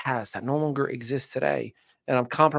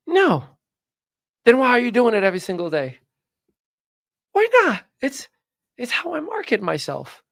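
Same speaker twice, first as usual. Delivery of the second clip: higher, louder, fast retold back-and-forth; mock high retort; 9 semitones higher, 4 dB louder than usual. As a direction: fire back in a squeaky mocking voice. Retold argument with stepfather.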